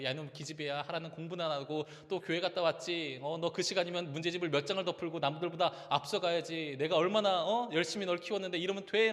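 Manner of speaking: rising into a shouted scolding; scolding aunt. Retold or imitated. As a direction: nag her like a fed-up aunt. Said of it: "라면서 막 쏘아붙이죠". (꾸짖듯 점점 높아지는 고모 목소리)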